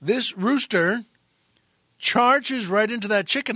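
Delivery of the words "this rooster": ordinary talk, nothing marked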